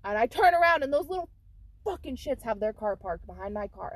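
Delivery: ordinary delivery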